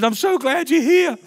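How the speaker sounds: a high, slow, tearful plea; crying man's words. Retold or imitated. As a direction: voice him weeping on my shoulder. Crying man voiced in story.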